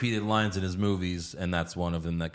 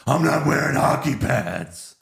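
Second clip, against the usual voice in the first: mock Batman retort; 5 semitones higher, 4 dB louder than usual.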